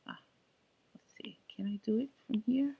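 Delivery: whispered aside to himself; breathy half-voiced muttering; whispering. Real, not acted.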